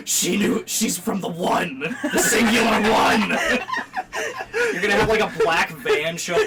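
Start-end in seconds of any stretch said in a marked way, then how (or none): 0.1-3.3 shocked employee. Retold or imitated. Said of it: "Some Walmart employee's like".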